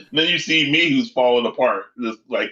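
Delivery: normal talking voice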